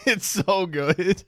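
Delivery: high, slow, laughing gush; real laugh breaking into the words. Real, not acted. Streamer cracking up at his own retelling, laughing.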